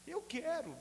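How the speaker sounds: high, loud eager cry of I want to; calling out to the pastor, eager and loud; eager shout. Retold or imitated. Answers the altar call.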